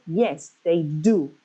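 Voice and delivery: "Yes" and "do" both stressed and drawn out; slow short answer, leaning on "Yes" and "do". Shown as a demonstration. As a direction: land firmly on "Yes" and "do". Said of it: "you have to put a stress".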